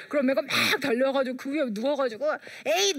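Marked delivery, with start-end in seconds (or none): 2.7-3.0 retold battle cry. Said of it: "막 이러는 거예요".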